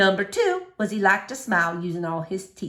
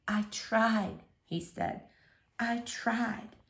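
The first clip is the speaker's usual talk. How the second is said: much softer, defeated; father about to cry; broken father; 10 dB quieter, much slower than usual. A narrator's reading. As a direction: let it crumble, almost to tears. Father breaking down.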